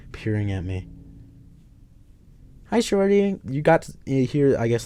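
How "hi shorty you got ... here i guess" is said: high, loud drawling greeting; lazy cocky hello; lightly voiced Clint.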